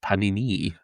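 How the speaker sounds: panini in a lightly French accent; soft, slightly French-accented panini. Shown as a demonstration. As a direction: say it like a French speaker. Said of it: "say in a French accent".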